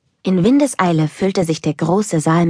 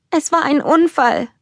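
a touch of tearful despair, voice high; understated sob of a guilty child; mild sobbing; 11 semitones higher than usual. Acted. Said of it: "schluchzte Elsa verzweifelt". (leicht schluchzend, verzweifelt)

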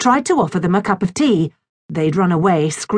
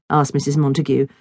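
ordinary delivery

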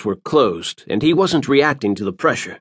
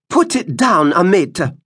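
put-on high, sharp old woman's snap; old woman snapping; 6 semitones higher than usual. A narrator's reading. Old woman snapping at Moms to drop it. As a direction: snap it like a stern old seer. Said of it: "the woman snapped".

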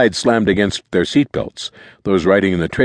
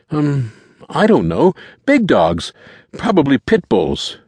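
high, slow, uncertain answer; high-pitched, halting boy's voice; hesitant teenage boy; 8 semitones higher, 3 dB louder, slower than usual. A narrator's reading. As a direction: grope for an answer when pressed. Boy unsure under questioning.

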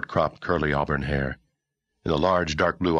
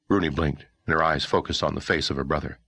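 plain reading voice

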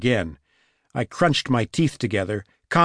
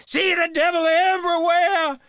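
screamed. A narrator's reading. Wild woman with a knife in the lobby, high scream. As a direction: shriek it frantically, far above normal pitch. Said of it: "she screamed".

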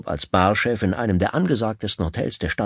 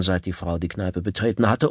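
ordinary delivery

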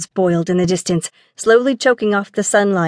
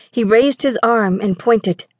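ordinary delivery

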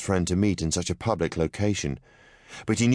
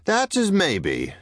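much higher and slow, a smile in the voice; a wry, unhurried concession; amused older gentleman; 13 semitones higher, 3 dB louder, much slower than usual. A narrator's reading. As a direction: tease her lightly, drawing it out. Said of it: "the hint of a smile appearing".

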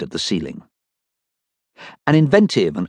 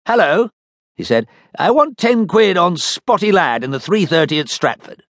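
captain's voice; 9 semitones higher than usual. Acted. Captain phones betting shop.

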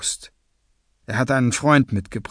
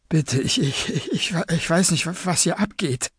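put-on stammering young captive, slightly raised pitch; nervous stammering; 4 semitones higher than usual. A narrator's reading. Captured young man pleads ignorance to gunmen, stammering. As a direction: stumble and repeat words in fear. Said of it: "stammelte der junge Mann".

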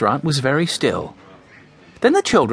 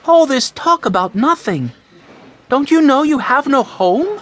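high-pitched exasperated mother; scolding mother; 11 semitones higher, 4 dB louder than usual. A narrator's reading. Mother scolding her squabbling son, exasperated. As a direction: scold the boys in a raised pitch.

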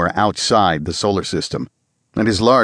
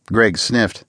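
plain reading voice

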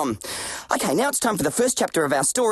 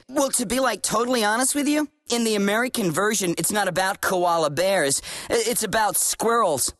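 put-on American accent. Playing American narrator.

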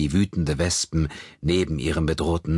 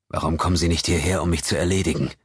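plain reading voice

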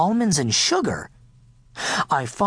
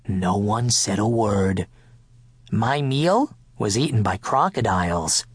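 ordinary delivery, nothing marked

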